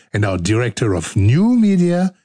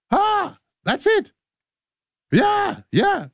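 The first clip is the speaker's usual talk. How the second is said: high falsetto; slower than usual. Acted.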